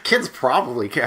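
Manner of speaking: ordinary delivery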